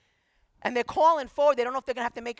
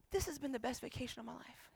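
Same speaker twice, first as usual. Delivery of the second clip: very soft, subdued retold reply; moved hush; 14 dB quieter than usual. Woman answering pastor days later, softly moved. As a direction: say it quietly, deeply touched.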